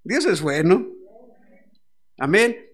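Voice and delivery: loud fervent preaching. Acted. Pastor proclaiming to his church, loud and fervent. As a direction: proclaim it to the congregation.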